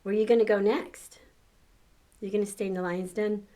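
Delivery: plain reading voice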